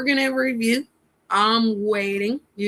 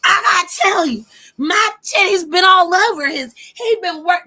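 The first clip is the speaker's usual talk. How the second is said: high, loud, whiny mock sobbing; mock crying woman; 10 semitones higher, 8 dB louder than usual. Mocking her rival's tearful phone call with a high wailing sob. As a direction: ham up her tearful confession. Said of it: "And she was like".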